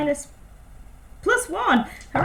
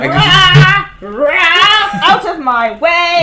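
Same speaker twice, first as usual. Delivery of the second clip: loud roars into a drawn-out shout; charging roar; 9 dB louder, much slower than usual. Acted. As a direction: bellow to clear the path. Player charges despite being told wait.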